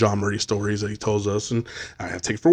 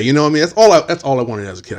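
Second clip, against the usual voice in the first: normal talking voice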